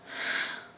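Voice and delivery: long breathy exhale; acted sigh, all air; sigh of relief. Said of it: "a sigh of relief".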